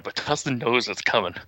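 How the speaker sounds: ordinary talk, nothing marked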